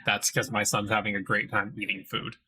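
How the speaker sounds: ordinary talk, nothing marked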